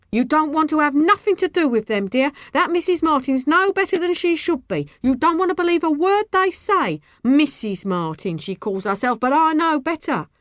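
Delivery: lightly voiced indignant neighbour; scornful warning. A narrator's reading. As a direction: warn her off those people. Woman warns child against the family.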